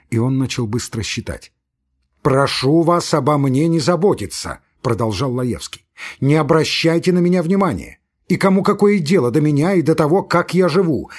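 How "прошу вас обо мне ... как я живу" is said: raised, louder, quick nervous outburst; a man on the edge of hysteria pushing people away; agitated protest. Acted. Agitated Laevsky protests to friends.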